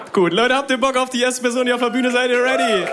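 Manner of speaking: high, energetic MC call; hyping the crowd. Real, not acted. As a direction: show host warming up the room. Host hypes the crowd.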